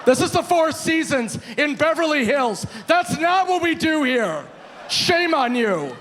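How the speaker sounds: hammed-up public shaming; mock scolding. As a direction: play the scandalised moral authority. Mock scolding in front of bar.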